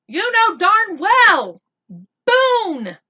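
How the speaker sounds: slow, blazing shout of the name; angry shout. Acted. Boy shouts at friend in anger.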